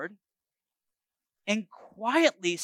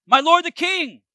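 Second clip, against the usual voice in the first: high, loud call to the king; a servant hailing his king from afar; 9 semitones higher, 5 dB louder than usual. A narrator's reading. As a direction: call after him across the distance. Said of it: "shouted after him".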